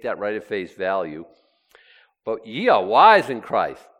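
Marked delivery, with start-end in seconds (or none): 2.2-3.9 loud, pointed mock compliment; sarcastic. Preacher quoting Paul to the self-styled wise, sarcastic. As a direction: punch the praise so it rings false. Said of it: "That's sarcasm".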